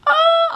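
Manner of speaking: loud high crying wail; mock wail. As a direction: burst out in an exaggerated wail. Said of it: "I was crying my eyes out".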